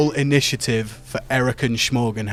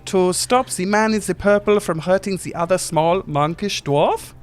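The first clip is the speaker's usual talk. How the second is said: much higher, fluttery genie voice; squeaky little genie gushing over Titus; small Germanic genie; 9 semitones higher than usual. Acted. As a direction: play a tiny fussy genie, flustered.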